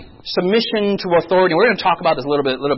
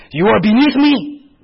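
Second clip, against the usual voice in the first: slow, higher, haughty Korean elder; imitated Korean elder; 5 semitones higher, 4 dB louder than usual. Korean elder looking down on young pastor, imitated haughtiness. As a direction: pull rank with mock grandeur. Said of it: "I'll talk to someone in Korea".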